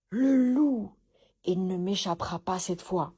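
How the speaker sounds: loud triumphant cry on the first words; hunter's shout. A narrator's reading. Hunter spotting the sleeping wolf, loud shout. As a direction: yell it as you spot the prey. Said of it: "cria le chasseur".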